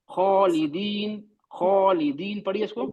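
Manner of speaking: slow खालिदीन with a heavy खा. Shown as a demonstration. Teacher modelling the word for Saima, slow and heavy. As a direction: model खालिदीन slowly for the student. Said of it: "आप ये इसको पढ़िए".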